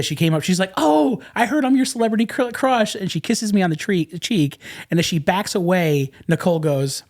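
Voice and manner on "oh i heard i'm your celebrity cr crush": high, sweetly teasing retold greeting; gushing actress. Celebrity greeting the man who has a crush, high gushing. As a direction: lift the pitch to imitate her.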